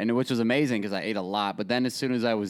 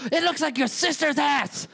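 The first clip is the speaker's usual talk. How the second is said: much higher put-on old Italian grandma. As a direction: squeal it like a cranky old grandma. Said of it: "And she goes".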